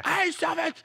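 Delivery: high, soft imitation of the friend; a hint of a whiny denial; mock retort. Friend denying being selfish, high mock voice.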